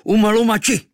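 high, loud war cry; Kvíkvék crying out before shooting; battle cry. Acted. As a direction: shout it as you loose the arrow. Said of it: "vykřikl".

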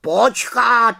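high, loud sharp wait; interrupting raven. A narrator's reading. Raven Abraxas interrupts the witch, loud and high. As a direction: cut her off like an alarmed raven. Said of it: "skočil jí do řeči Havran".

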